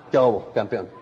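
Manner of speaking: low, mock-hard greeting; tough-guy greeting. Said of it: "Porque yo quería verme más malo". (saludo de tipo duro, más grave)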